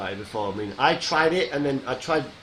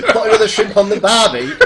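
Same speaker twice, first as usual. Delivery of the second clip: laughing, hammed-up Australian accent; a laughing stab at an Australian accent, louder and higher; mock Australian accent; 6 semitones higher, 9 dB louder than usual. Asked to do the line, a laughing fake Australian accent. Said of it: "do what Garrett said".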